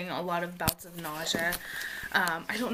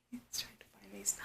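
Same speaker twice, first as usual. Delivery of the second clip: whisper; 13 dB quieter than usual. Real, not acted. Whispered aside.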